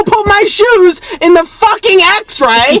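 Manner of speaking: slow, very high mock meltdown; going crazy. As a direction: screech each word out in hammed-up exasperation. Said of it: "he went that crazy in this situation".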